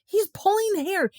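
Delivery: very high-pitched outcry; frantic complaint. A narrator's reading. Boy complains during the fight, very high outcry. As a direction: squeal the complaint mid-scuffle.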